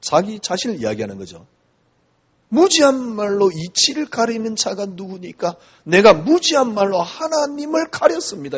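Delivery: ordinary talk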